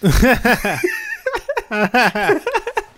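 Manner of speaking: high, hearty real laugh; long laugh bursting out at a joke; loud burst of laughter. Real, not acted.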